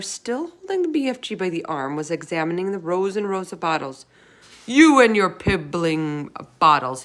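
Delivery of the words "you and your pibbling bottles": loud, slow scornful shout. A narrator's reading. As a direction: sneer it loudly. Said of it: "he shouted".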